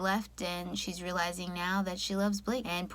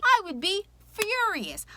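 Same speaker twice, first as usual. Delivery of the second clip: much higher and louder on furious; mock fury; 10 semitones higher, 3 dB louder than usual. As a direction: pretend outrage. Imagining being Blake.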